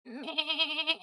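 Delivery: goat bleat. Shown as a demonstration. Streamer performs goat impression, quavering bleat. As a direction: bleat like a goat on one long note. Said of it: "I need to do my goat impression now".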